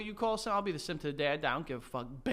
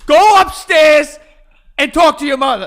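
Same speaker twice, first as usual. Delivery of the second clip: yelling dad; 8 semitones higher, 11 dB louder than usual. Retold or imitated. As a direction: roar it like an angry father. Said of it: "things your dad has yelled".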